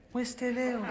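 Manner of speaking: a touch of a whiny charity caller; lightly voiced plaintive plea; mild mock pitiful voice; mock pleading. Mimicking charity's call.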